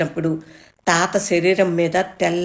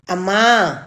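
loud call; 5 dB louder, slower than usual. A narrator's reading. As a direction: shout for mom. Boy calls mother again.

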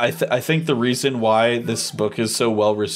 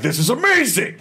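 much higher, mock-thrilled gush; gushing excitement; 12 semitones higher than usual. Fan recalling loving every Warhammer book, much higher and excited. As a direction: play up how thrilled you were. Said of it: "I've been like".

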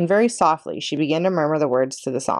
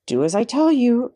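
higher, urgent order; urgent command; 7 semitones higher than usual. A narrator's reading. Tucker urgently commands Chester to keep playing. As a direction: insist he obey now. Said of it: "commanded the mouse urgently".

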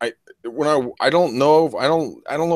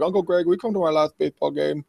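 lightly voiced little kid, higher pitch; six-year-old nephew; 4 semitones higher than usual. Young nephew asking uncle to his last game, lightly imitated child. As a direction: lightly imitate a small boy's pleading question. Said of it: "he's like".

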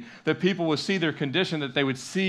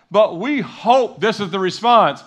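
ordinary talk, nothing marked

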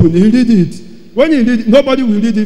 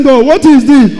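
higher and louder, pressing; urgent question; 5 semitones higher, 5 dB louder than usual. Retold or imitated. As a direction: demand an answer from God. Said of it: "when I asked him".

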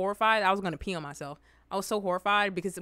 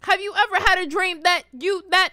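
higher, louder stuttering imitation; iconic stutter; 7 semitones higher, 6 dB louder than usual. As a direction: stumble excitedly over repeated words. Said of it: "the stutter is iconic".

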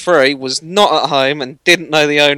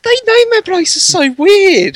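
much higher, slow squealing disbelief; amused squeal; 6 dB louder, slower than usual. Real, not acted. Mocking weird place names.

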